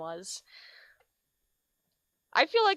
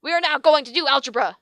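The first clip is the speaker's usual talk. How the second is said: villain teacher; 4 semitones higher, 7 dB louder than usual. Acted.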